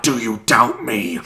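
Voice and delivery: mock imperious. Shown as a demonstration. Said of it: "One, one more go".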